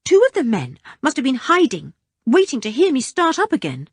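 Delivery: put-on young boy rushing out his frightening tale; anxious boy. Richard continues his story.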